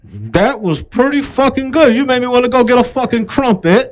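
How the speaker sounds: mock British accent. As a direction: answer in a playful put-on British voice.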